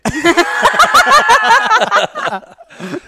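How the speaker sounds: loud, high, helpless laugh; big burst of laughter. Real, not acted. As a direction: crack up at your own slip. Laughing at spoiling the ending.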